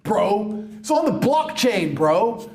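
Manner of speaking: high whiny crypto bro; mocking crypto bro. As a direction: parody an eager NFT fan. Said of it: "people were saying to me".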